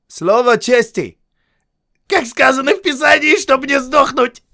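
громкий высокий голос наглого сержанта; кричит, выпрашивая жизнь у офицера; сержант Хейксвилл. A narrator's reading. (наглый вопящий сержант)

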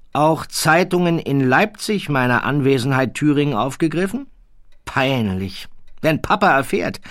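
a condescending royal drawling his words; haughty prince. Acted. The prince corrects the baron, high and lofty. Prince fretting over press.

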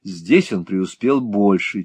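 ordinary delivery, nothing marked

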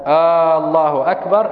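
الله opened with a long questioning aa, aallah; long hamza on Allah. Shown as a demonstration. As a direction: stretch the first vowel of الله like a question. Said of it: "مد الهمزة من لفظ الجلالة". (مدّ همزة الله كأنه سؤال)

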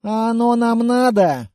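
put-upon grumble, unconvinced; grumbling. A narrator's reading. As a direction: grumble it reluctantly, stretching the words. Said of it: "проворчал я".

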